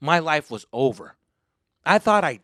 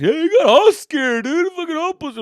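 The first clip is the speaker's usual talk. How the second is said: taunting; 14 semitones higher, 8 dB louder than usual. Retold or imitated. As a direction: jeer at him like a bratty cousin. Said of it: "he just kept talking shit".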